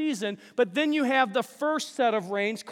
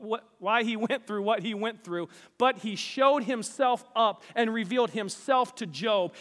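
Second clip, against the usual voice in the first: ordinary delivery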